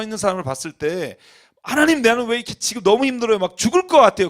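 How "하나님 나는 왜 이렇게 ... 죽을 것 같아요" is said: loud, high cry to God; desperate plea. Acted. Struggling believer complains to God, desperate loud high plea. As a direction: wail the complaint upward, at full volume. Acted desperate prayer of sufferer.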